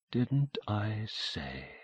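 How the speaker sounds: slow, soft murmur under her breath; murmured to herself. A narrator's reading. Mother murmurs to herself, looking at the window, slow and soft. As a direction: say it slowly to yourself, barely aloud. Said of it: "she murmured to herself".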